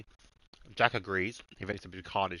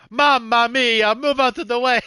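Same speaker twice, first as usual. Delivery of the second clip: put-on shrieking, slow Italian accent, much higher and louder; 14 semitones higher, 13 dB louder, slower than usual. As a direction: yell it in an over-the-top Italian accent. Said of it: "he rips out an Italian accent".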